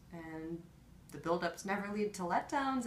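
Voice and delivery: ordinary talk, nothing marked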